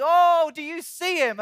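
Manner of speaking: acted excitement, high and loud with a drawn-out oh; delighted exclamation. God the Father pointing out his chosen one, delighted.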